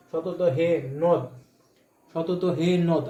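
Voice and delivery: সতত হে নদ, syllables drawn out slowly; demonstrating the meter to students, slowly; slow syllable count. Shown as a demonstration. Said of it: "যদি উচ্চারণ করে আমি".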